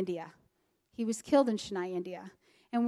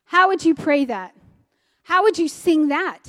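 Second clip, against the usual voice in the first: louder, pointed retold challenge; 4 semitones higher, 6 dB louder than usual. Pastor testing how to pray a genealogy, challenging. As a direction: throw each question down like a dare. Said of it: "he was throwing it out as a challenge".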